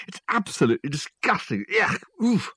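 high-pitched spluttering disgust; revolted. Acted. Swimmer spitting out canal water, revolted. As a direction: groan with revulsion as if clearing a foul taste.

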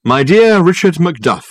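pompous, persuasive detective talking up his case; lofty and smooth, selling it hard; Dirk's grand phone voice. Acted. Dirk greets Richard.

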